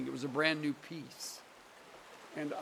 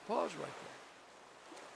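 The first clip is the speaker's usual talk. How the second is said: hushed slow whisper; 3 semitones higher, 10 dB quieter, much slower than usual. Shown as a demonstration. Preacher showing the congregation how to stop at Selah, hushed whisper. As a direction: whisper it slowly, drawing out pause. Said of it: "don't just race on".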